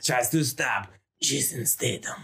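slow mock Spanish accent; hammed-up Spanish movie-trailer narration; Antonio Banderas. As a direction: draw it out with a put-on Spanish accent. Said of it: "that's the Banderas".

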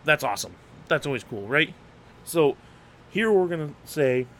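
usual speaking voice

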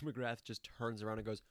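ordinary talk

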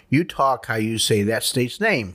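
ordinary talk, nothing marked

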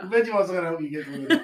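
laughing. Real, not acted. Laughing while repeating the joke.

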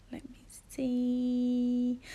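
slow, drawn-out whisper while checking; murmuring to self. Real, not acted. Host checking the schedule for the week, murmuring slowly to herself. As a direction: think aloud under your breath, stretching it out.

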